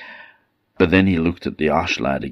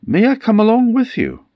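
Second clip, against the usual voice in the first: high character voice; 5 dB louder than usual. Acted. Man asks to join the boat.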